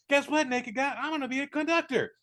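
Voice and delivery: very high, giddy mock announcement; hammed-up excitement. Conductor telling the man in bed his news, hammed-up excitement. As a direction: squeal it like over-keen good news.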